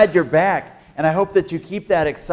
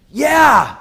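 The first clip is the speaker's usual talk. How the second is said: cheer; 11 semitones higher, 8 dB louder than usual. Acted. Crowd cheer.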